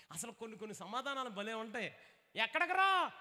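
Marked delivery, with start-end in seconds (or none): 2.3-3.1 mock scolding shout. Parent scolding son leaving.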